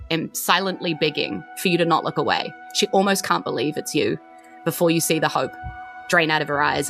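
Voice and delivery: ordinary talk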